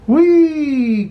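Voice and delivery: high delighted whee; joyful whee. A narrator's reading. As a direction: squeal like a kid on a ride. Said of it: "cried Dot".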